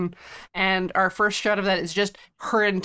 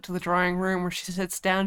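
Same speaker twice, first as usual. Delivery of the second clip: ordinary talk, nothing marked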